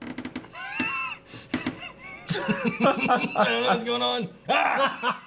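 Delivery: high laughing panic before a slap; nervous laughing shout. Real, not acted. About to be slapped, laughing.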